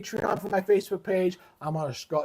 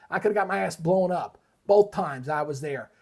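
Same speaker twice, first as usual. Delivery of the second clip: ordinary talk, nothing marked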